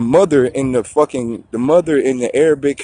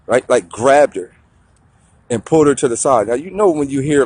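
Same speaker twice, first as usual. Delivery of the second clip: normal talking voice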